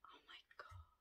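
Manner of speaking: breathless whispered awe; hushed, amazed whisper; awed whisper. Real, not acted. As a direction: whisper it, stunned by the colour. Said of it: "awed at purple liner".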